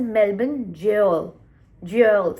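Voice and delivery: "jail" said slowly and emphatically as one syllable; demonstrated pronunciation. Shown as a demonstration. Vlogger explaining the gaol spelling, "jail" pronounced carefully as one syllable. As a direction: show how "jail" sounds, loud and drawn out. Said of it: "it's pronounced that as".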